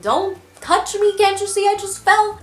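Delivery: mock indignant protest. Embarrassed student to the stranger picking her up, mock indignant. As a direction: push him off in a high, wounded voice. Acted protest after falling over.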